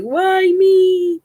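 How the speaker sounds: high, drawn-out "why me" plea; plaintive cry. Acted. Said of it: "you'd be like".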